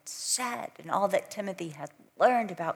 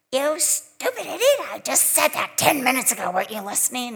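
much higher, louder, fast shrieking scold; scary teacher yelling; 3 semitones higher, 6 dB louder, faster than usual. Retold or imitated. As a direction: blow up at a second-grader like a terrifying teacher. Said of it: "you never knew if she was gonna say".

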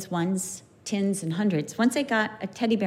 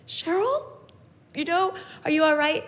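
gentle concern; 6 semitones higher than usual. Retold or imitated. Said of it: "She's like".

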